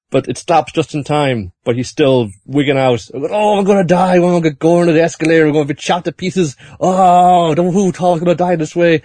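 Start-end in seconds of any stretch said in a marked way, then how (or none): 3.1-9.0 high, rushed mock terror; hammed-up panic. Joseph convinced he'll die on the escalator, in high mock terror. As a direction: freak out like the escalator will chop you up.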